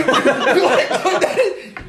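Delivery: laughing high in disbelief; can't believe he did that, cracking up; high disbelieving laugh. Real, not acted. Friend laughing at the dog park story, high disbelieving laugh.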